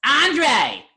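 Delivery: very high, loud hammed-up scream; screamed name. Storyteller summoning the clerk across the store, screamed. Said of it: "I screamed it".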